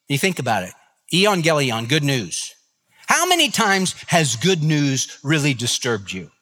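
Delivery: ordinary talk, nothing marked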